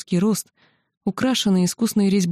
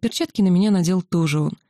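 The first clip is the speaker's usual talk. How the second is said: ordinary delivery, nothing marked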